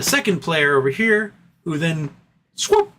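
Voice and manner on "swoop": squeaky high swoop; high swoop sound. Pretend. Acting out the con's grab.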